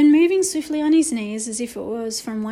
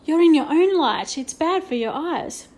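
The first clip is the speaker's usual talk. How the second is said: raised teacher voice; a teacher scolding gently; stern teacher; 5 semitones higher, 4 dB louder than usual. A narrator's reading. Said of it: "said the art teacher".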